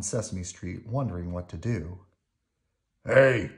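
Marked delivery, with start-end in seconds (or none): 3.0-3.5 loud, rough grouch call; gruff puppet grouch; Oscar. A narrator's reading. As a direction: heckle a passer-by loudly. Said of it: "Oscar called as Bert passed".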